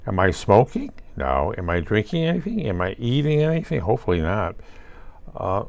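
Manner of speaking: ordinary talk, nothing marked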